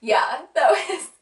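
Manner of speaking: fighting back tears with a laugh; teary laugh. Real, not acted. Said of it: "I'm trying not to cry on camera".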